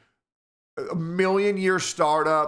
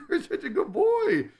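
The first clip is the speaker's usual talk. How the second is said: very high giggly pet praise; 14 semitones higher than usual. Real, not acted. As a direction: gush at the kitten through laughter. Praising the cat laughing.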